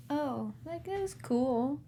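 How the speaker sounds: quiet, barely impressed shrug; underwhelmed. Retold or imitated. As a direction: say it like it was just okay. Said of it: "I'm underselling it for y'all".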